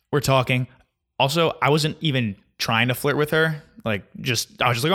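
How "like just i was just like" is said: normal talking voice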